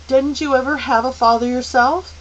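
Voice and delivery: high, loud, indignant demand. A narrator's reading. Meg to the man in the chair, a loud demand. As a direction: fire it back at him. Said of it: "Meg demanded".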